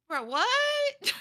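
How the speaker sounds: shocked squawk breaking into laughter; incredulous. Retold or imitated. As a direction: blurt it out in disbelief, laughing after. Baffled retort to clueless reply.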